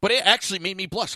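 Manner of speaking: ordinary talk, nothing marked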